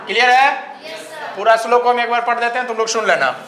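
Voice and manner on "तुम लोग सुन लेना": normal talking voice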